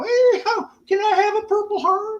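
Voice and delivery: high, whiny mocking voice; squeaky put-on complainer voice; mock whiner. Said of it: "You whine and piss around".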